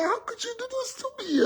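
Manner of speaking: very high wailing impression of a wife; mock crying. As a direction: act out a tearful, squeaky reproach. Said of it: "this woman started to cry".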